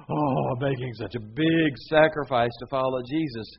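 loud, hammed-up martyr sigh; mock self-pity. Preacher mocking a self-pitying follower, hammed-up. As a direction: play the sulky sacrificer for laughs.

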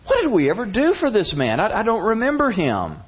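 high, perplexed voice; puzzled questioning. Retold or imitated. King asking attendants about his rescuer, puzzled.

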